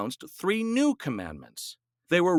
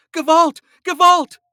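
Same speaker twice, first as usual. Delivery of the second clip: very high, very loud shouts; shouted; 11 dB louder than usual. A narrator's reading. Wife rolling downhill in the trunk, loud shouting. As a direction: scream it in terror. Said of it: "Gittel shouted".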